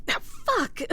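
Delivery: sharp high cry of pain; pained yelp. Acted. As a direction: yelp as the elbow lands. Elbowed while wounded.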